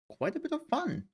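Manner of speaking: sudden jump into high falsetto; playful squeak. Pretend. Streamer telling chat about yesterday, playful falsetto squeak. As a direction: squeak the phrase out in a put-on high voice.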